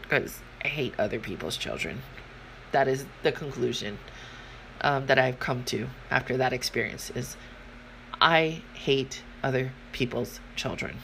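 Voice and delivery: deadpan quiet. Real, not acted. Her conclusion said low and quiet.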